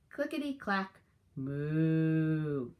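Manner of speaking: lively storybook read-aloud; animated picture-book narration; reading to children. Acted. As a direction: tell it to a class of young kids.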